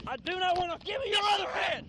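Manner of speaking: high loud command; shouting. Real, not acted. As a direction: yell the order. Officer shouts during struggle.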